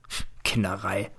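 dismissive whispered scoff. A narrator's reading. Teacher mocking the boy's diary, whispered scoff. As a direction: brush it off under your breath.

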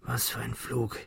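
very soft, feeble voice; quiet, dazed. A narrator's reading. Thomas speaks after his fall, weakly. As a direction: barely get the words out. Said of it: "begann leise zu sprechen".

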